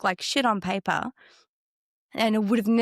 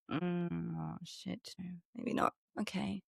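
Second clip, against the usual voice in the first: much softer, sinking, almost to herself; deflated murmur; 2 semitones lower, 13 dB quieter, slower than usual. Retold or imitated. Hearing the price list, she murmurs her hopes away, much softer. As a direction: let the air go out of the plan. Hearing costs, losing heart.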